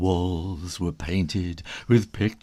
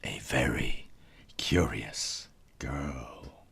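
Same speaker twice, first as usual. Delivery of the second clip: hushed refrain; 7 semitones lower, 7 dB quieter than usual. A narrator's reading. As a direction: share it like a secret with the children. Storyteller's refrain to children.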